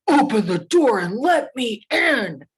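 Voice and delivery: loud, slow, drawn-out bellow; big bad wolf roaring at a locked door; menacing shout with swooping stresses; demanding wolf. A narrator's reading.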